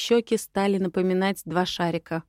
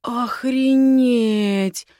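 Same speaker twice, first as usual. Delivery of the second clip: stunned exclamation; 4 semitones higher, 5 dB louder, much slower than usual. Acted. Heroine stunned by the view.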